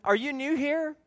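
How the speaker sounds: high, sing-song are you new here; mock the eager greeter; high greeter question. Quoting greeter's question again.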